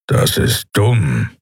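slow, grumpy growl. A narrator's reading. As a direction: growl it out slowly, with disdain. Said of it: "knurrte Keru".

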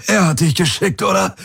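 high, strained croak; croaking accusation from a battered fighter. A narrator's reading. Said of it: "krächzte er".

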